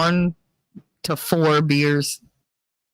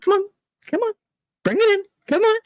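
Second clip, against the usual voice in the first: eager coaxing; much faster than usual. Retold or imitated. Coaxing a hug.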